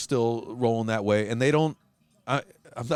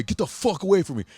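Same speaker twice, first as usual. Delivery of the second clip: higher, louder repeat of the warning; mock yell; 7 semitones higher, 3 dB louder than usual. Tattooed guy walking off, repeating his warning, mock yell. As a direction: snap it again as he walks off. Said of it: "they're walking away".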